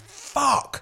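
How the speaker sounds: high yelped curse; high startled yelp; sudden shout. Retold or imitated. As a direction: blurt it out, startled. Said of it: "and I shouted".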